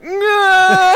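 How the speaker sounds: high, loud scream of pure fear; terrified scream. Retold or imitated. Screaming no as the monkey bites. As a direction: shriek no at the monkey. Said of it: "I'm like".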